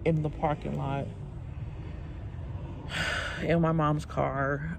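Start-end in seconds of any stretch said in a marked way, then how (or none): 1.1-3.4 long weary sigh. Real, not acted. Bored waiting in car.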